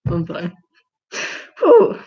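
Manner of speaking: normal talking voice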